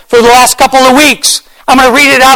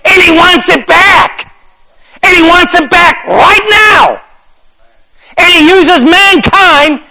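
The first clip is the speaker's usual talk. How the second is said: preaching shout; 5 semitones higher, slower than usual. Real, not acted. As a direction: drive it home at the peak. Preacher's rising climax.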